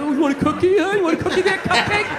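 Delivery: mocking taunt. Comic imagining taunting the counter guy, sneering mock bully. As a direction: jeer it like a bully picking a fight.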